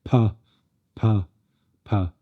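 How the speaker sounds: "p" with too much air, a heavy puff; the wrong, splashy p; overdone p. Shown as a demonstration. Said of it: "We're not looking for".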